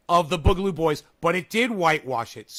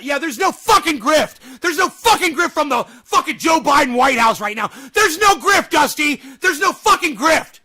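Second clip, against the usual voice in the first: furious sarcastic yelling, much louder and higher; 7 semitones higher, 9 dB louder than usual. Real, not acted. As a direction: scream it out at full force, mocking the denial. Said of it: "I'm yelling and screaming".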